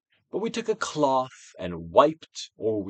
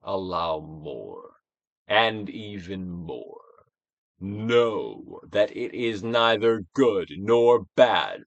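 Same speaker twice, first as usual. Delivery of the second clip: guided meditation; 4 dB louder than usual. Acted. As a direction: coax the listener to let feelings pass.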